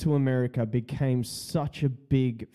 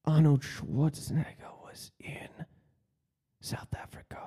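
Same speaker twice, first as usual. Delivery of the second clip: hushed, voiceless whisper, trailing softer; conspiratorial whisper; 5 dB quieter than usual. Acted. Acting out a man telling the boys his idea, conspiratorial whisper. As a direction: whisper it like a secret plan.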